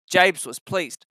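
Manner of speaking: usual speaking voice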